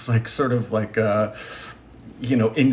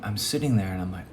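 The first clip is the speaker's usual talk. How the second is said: ordinary talk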